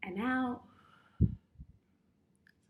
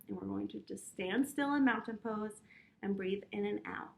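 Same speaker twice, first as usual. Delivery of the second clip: calm guided breathing; 3 semitones lower, 4 dB quieter than usual. Acted. Teacher guiding children through calm breathing. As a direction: lead the little ones gently through breaths.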